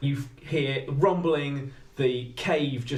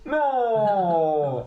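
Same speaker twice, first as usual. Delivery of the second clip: long, high wail of protest; dejected robot dog, loud drawn-out cry; Buddy; 6 semitones higher, 5 dB louder than usual. Acted. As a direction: howl it out, high, then sliding down. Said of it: "Buddy goes".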